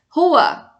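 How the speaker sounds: sharp command sending someone off; ordering out. Shown as a demonstration. Teacher demonstrating a one-word order, commanding. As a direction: throw him out with one word. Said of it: "Tô mandando alguém pra rua".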